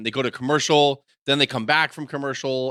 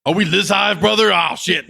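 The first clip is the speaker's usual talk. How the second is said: loud, higher wrestler voice; mock Hulk Hogan; 6 semitones higher, 6 dB louder than usual. Wrestler reacting backstage, mock alarm. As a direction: push it up like a hyped wrestler.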